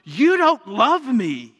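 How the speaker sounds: put-on loud, slow, high child's wail; a sulking child throwing it back at the parent; whiny child. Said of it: "And the child responds".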